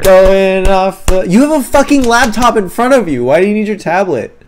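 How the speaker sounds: loud, high exasperated yell; incredulous yelling. Real, not acted. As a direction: shout it in disbelief. Incredulous outburst at friend.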